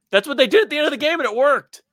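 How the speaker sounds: excited. Real, not acted. Excited emphatic point.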